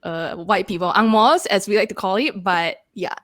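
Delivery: everyday Singlish accent, as with friends; Singaporean accent. Shown as a demonstration. Host apologises to teased co-hosts, in a Singaporean accent. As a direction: speak as at home in Singapore. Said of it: "That's my Singaporean accent".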